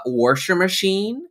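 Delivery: washer said as warsher; older people's pronunciation. Retold or imitated. Guest quotes older people, washer machine with an added R. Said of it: "a lot of older people say".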